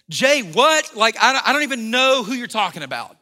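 loud, high, bleeped-out cursing; cursing denial. Retold or imitated. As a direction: swear your way out of it, loud. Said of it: "call down curses and he swore".

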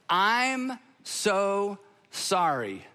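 very slow, each word weighed; slow sincere apology. Shown as a demonstration. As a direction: say it slowly and stop. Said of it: "anything you say after that sounds like an excuse".

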